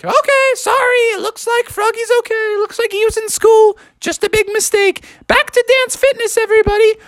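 very high, hurried chirpy apology; flustered mother frogs. Acted. Mothers leave for dance fitness, flustered and squeaky. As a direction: squeak sorry and hurry off.

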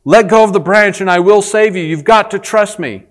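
a voice from heaven calling; voice from above. Acted. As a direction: call down to him with authority. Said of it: "And he hears a voice".

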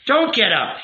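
high, firm order; commanding. A narrator's reading. Rabbi stopping the youth from leaving, commanding. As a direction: stop him before he rises. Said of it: "he commanded".

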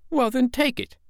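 put-on very high, insistent boy's voice; daring child. A narrator's reading. Michael daring his father to take it, insistently. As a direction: dare him in a child's voice.

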